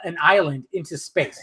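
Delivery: normal talking voice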